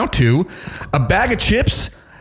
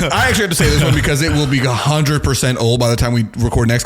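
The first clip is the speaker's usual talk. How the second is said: ordinary talk, nothing marked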